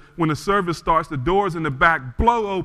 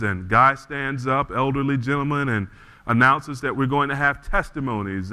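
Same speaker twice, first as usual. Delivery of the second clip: normal talking voice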